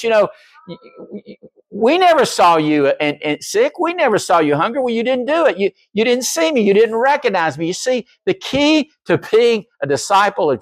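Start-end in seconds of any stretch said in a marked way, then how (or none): 0.0-7.7 high, flustered excuses then rebuke; goats and judge. Retold or imitated. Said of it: "he'll say to those who are categorized as goats".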